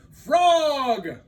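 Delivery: high, loud call; boastful cry. Acted. Toad boasting to Frog from the stage, loud call. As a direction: show off to your friend. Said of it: "said Toad".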